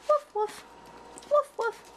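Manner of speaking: high, spoken woof-woof; playful dog imitation. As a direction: play at being a little dog. Mimics a dog barking.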